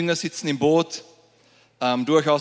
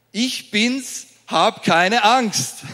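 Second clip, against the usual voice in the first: higher, louder and slow, an emphatic Jesus; Jesus retold; 6 semitones higher, 4 dB louder, much slower than usual. Preacher replays Jesus' words to the church, higher, louder and slow. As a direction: announce it big and clear, as Jesus. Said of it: "Das ist Jesus, oder?".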